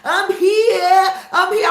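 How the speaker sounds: high, loud, breathless repeating; excited girlfriend. Retold or imitated. Girlfriend Seraphina calling from outside, squealing excitedly. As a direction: squeal it like she's just arrived. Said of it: "She was like".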